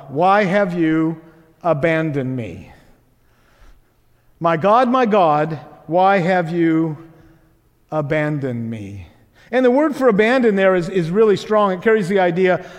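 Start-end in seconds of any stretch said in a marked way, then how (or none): 0.1-9.1 slow, falling away at the end; solemn scripture reading. A narrator's reading. Preacher voicing Jesus' cry to God, solemn and slow. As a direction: let each phrase sink, with long pauses.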